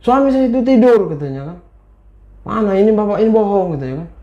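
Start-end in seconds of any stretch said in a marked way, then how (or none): 0.0-1.1 loud, high insistence; storyteller acting a wife's furious denial; angry. A narrator's reading. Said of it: "Marah ibu ini". 2.4-3.8 high accusing protest; acted indignant accusation, pitched up; angry. A narrator's reading. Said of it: "Marah ibu ini".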